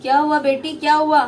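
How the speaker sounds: father alarmed at daughter's arrival. Acted. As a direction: rush to ask what happened.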